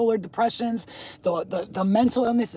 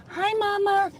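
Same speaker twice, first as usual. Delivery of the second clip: high, singsong pet greeting; 11 semitones higher, slower than usual. Real, not acted. Cat lover greeting a stray, high cooing call. Calling to the cats.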